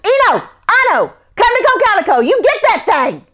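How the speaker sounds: high, loud, fast urgent call; calling the dogs. Acted. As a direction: shout to the dogs outside.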